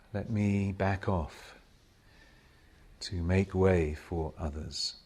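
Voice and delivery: slow, hushed prayer with long silences; meditative prayer. Real, not acted. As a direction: pray each short petition, leaving space after it. Meditative prayer of self-surrender.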